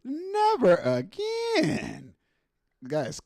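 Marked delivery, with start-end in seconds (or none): 0.0-2.1 much higher, very slow stretched vow; drawn-out high moan. Acted. Vowing never again, a slow high stretched moan. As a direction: stretch it out like a sworn oath. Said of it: "repeats slowly".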